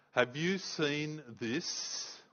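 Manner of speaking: drawn out slowly, each word weighed; slow judge repeat. Retold or imitated. Judge to the solicitor, drawn out slowly and pointedly. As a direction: let the judge savour the damning question. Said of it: "he says".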